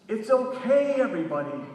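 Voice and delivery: loud call after a crowd; yelling. Retold or imitated. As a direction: shout it out to reassure them. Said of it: "I'm yelling after them".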